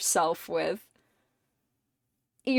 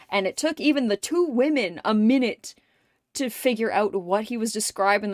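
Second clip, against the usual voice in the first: normal talking voice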